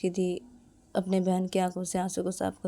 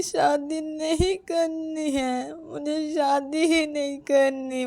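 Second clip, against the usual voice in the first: high, drawn-out crying wail; slow, high-pitched tearful lament; tearful praying; 8 semitones higher, slower than usual. Acted. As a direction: plead with God through acted sobs. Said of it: "रोने लगती है".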